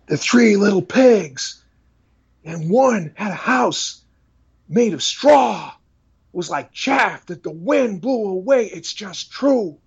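very high, slow, intense preacher reading a bedtime story; Paul Washer impression. Paul Washer reads the three little pigs with preaching passion. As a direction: deliver a kids' story with fiery sermon passion, slowly. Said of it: "a little bit of his voice".

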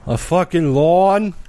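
high, slow disbelief; incredulous. Real, not acted. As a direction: ask it in shocked disbelief. Incredulous reaction.

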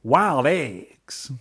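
WILD EGGS slowly stretched out; drawn-out name. Acted. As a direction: draw out "Wild Eggs" for effect. Savouring the restaurant's name.

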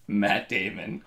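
usual speaking voice

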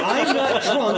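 slow, laughing fake drunk. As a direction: drag it out like a drunk. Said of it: "it's the tacos".

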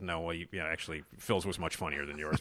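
low, hushed private thought; inner thought. Retold or imitated. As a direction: say it under your breath. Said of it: "I'm thinking to myself".

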